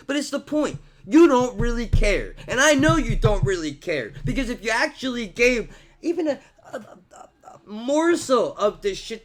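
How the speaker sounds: high, loud accusing voice; angry accusation. Real, not acted. Vlogger accuses critics of not caring, loud and angry. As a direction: jab it at them hard.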